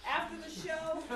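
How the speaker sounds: giggling through the words; cracking up mid-read; laughing. Real, not acted. Reader breaks into laughter.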